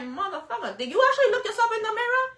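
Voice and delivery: high, fast, laughing disbelief; incredulous comeback. Retold or imitated. Clapping back at a TikTok hater, high and laughing. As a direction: fire back, cracking up, high and quick. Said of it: "I'm like".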